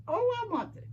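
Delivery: high, excited squeal of wanting; playful high-pitched gasp of longing; eager viewers. Retold or imitated. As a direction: mimic viewers gushing over the box. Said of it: "Y'all gonna say".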